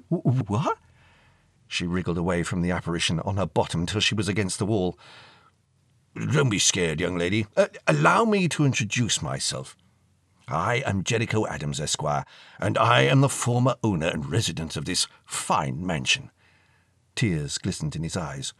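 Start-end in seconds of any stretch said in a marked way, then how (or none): 0.1-0.8 stammered, gasping question, pitched up; frightened teenage stammer; startled girl. Acted. 6.1-16.3 put-on grand old gentleman introducing himself; reassure, then announce yourself with pomp; old-fashioned, ceremonious elderly voice; courteous old ghost.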